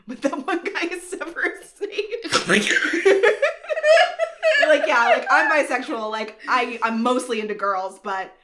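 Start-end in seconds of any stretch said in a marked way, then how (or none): 0.1-6.1 bursting into laughter; laughing. Real, not acted. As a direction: laugh through the words. Cracking up at own joke.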